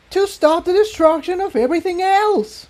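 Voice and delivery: very high angry shout; shouting back. A narrator's reading. Tiptoe shouts back at Incendia, angry high shout. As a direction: throw it right back at her. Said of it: "Tiptoe shouted back".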